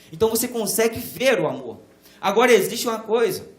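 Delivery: loud, rising pulpit fervour; preaching at full force. Acted. Pastor urging the church to see love, fervent preaching. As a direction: preach it out to the pews.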